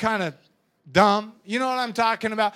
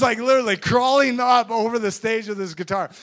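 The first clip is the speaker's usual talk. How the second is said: ordinary talk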